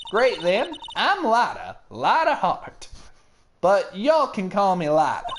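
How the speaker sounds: lightly put-on Southern accent for Lotta; a friendly Southern belle introducing herself, light Southern accent. Photographer greeting locals, in a light Southern accent.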